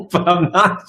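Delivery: high-pitched playful denial; mock protest. Acting out his protest to partner.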